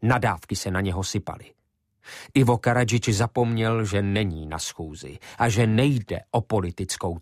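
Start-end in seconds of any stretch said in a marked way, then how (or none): none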